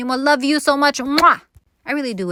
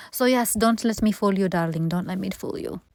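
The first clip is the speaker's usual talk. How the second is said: ordinary talk, nothing marked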